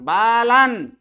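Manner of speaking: बालन as baa-laan, loud and drawn out, closing on n; halant final consonant. Shown as a demonstration. Teacher modelling the word for students, halant ending. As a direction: end बालन firmly on n, no vowel after. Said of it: "हलंत दिख रहा है".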